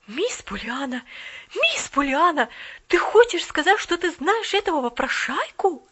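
a touch of indignant servant; indignant maid. A narrator's reading. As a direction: maid scolding the girl. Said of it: "с возмущением".

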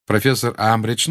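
ordinary delivery

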